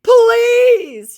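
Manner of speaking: very high, loud drawn-out please; begging scammer. Retold or imitated. Scammer begging her with a very high please. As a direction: beg to the heavens. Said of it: "he begs".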